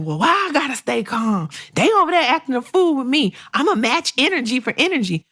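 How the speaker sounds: riled-up imitation of a coworker; indignant quote. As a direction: imitate someone itching to fight back. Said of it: "a lot of people are like".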